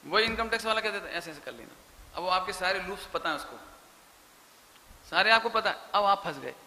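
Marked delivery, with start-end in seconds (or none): 1.1-2.0 hushed conspiratorial tip. Retold or imitated. Tax officer's hushed tip.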